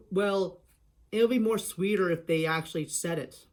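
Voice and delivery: normal talking voice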